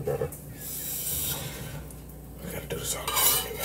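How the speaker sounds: whispering. Real, not acted. Muttered redo aside.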